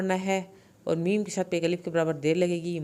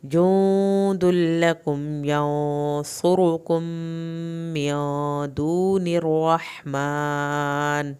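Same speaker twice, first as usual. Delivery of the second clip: slow, melodic tajweed chant; measured, devout recitation; Quran recitation; 5 dB louder, much slower than usual. Shown as a demonstration. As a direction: chant the verse slowly and fully. Full recitation of passage.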